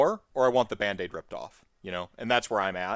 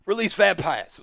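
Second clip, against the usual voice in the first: mock Cockney; 9 semitones higher, 6 dB louder than usual. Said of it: "I just call him up and".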